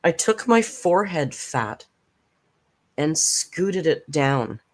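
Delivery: slow, deliberate reading of "scooted"; slow deadpan quote. Retold or imitated. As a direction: savour each word of the quote, slowly. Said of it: "the comment she made was".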